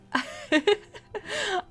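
high-pitched giggle; a short, high burst of laughter. Real, not acted. Laughing at own bad memory.

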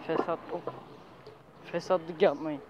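ordinary talk

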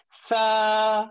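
Sa with the vowel drawn out; long sa. Shown as a demonstration. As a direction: stretch the a in Sa. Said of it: "elongate it a little bit".

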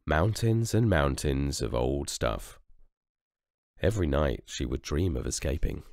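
lively storybook reading voice; story narration. A narrator's reading. Narrator reading to children, lively storytelling. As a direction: tell it like a bedtime story.

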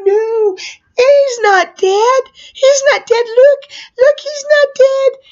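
high-pitched, urgent pleading; acted frantic reassurance. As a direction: plead over a mass of screaming kids. Pleading with screaming kids, retold.

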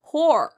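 emphatic teaching model of Poor; strong stressed syllable. Shown as a demonstration. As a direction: give Poor a firm push. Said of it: "좀 더 강하고 길게 발음하세요".

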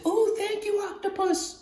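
very high, soft thanks; boy's voice. Acted. Boy thanked for the book, high and soft. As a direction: thank him in a tiny high voice. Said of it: "And the boy says".

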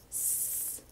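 "S" hissed unvoiced with tongue behind teeth; a long voiceless "S" hiss, tongue kept in; correct S sound. Shown as a demonstration. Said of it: "Do it like this".

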